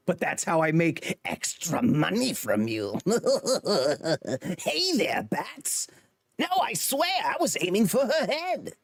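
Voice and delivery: high, cackling villain voice, drawn out then rising; Joker. Acted. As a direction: manic villain taunt breaking into laughter. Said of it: "That is my Joker impression".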